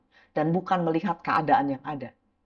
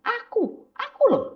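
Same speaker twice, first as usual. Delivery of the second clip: higher, gently insistent God voice; God insisting; slower than usual. Retold or imitated. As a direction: lightly play God pointing to himself. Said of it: "Tuhan bilang".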